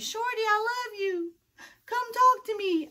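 high, slow, crying plea from outside; pleading ex. Acted. Stalker ex pleads outside window.

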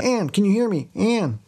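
high-pitched call to a sister. Retold or imitated. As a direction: call upstairs for someone, acting it out. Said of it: "she calls out to her sister".

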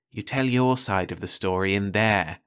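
ordinary delivery

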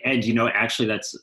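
ordinary talk

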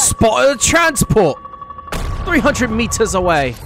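high, slow British callout; violently British soldier voice; Crawford impression. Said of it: "My best Crawford impression".

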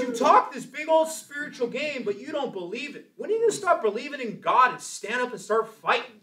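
high, loud surfer-dude impression; Dennis. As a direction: challenge him in an imitated surfer voice. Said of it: "this is how he talks".

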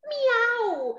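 high meowing cat. Acted. The cat greets the ogre, high meowing. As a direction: meow like a sweet little cat. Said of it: "el gato le dijo".

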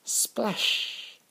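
breathy whispered splash sound. Acted. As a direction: hiss the splash out. Dog falls into pond.

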